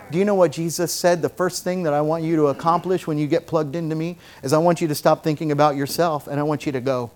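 usual speaking voice

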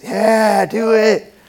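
put-on high, loud teenage buddies urging him on; imitated peer-pressure shouts; egging-on buddies. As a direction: goad a friend like a rowdy gang. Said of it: "you know, just that peer pressure".

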